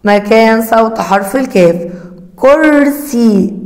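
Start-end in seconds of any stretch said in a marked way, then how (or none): 2.4-3.5 slow sounding out. Shown as a demonstration. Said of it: "استمع".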